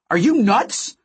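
high incredulous yell; alarmed shout. A narrator's reading. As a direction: shout it in disbelief. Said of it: "I shouted".

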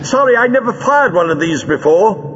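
high call across the room; called-out apology. A narrator's reading. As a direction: call back an apology from afar. Leela calls across the room.

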